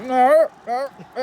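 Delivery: hammed-up scientist fighting his own arm; panicked protest. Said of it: "because he's like".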